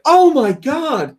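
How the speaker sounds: high, drawn-out cry of alarm; hammed-up "discovering a disaster" cry; retold shock. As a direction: exclaim in acted horror. Retold shock at cut hand.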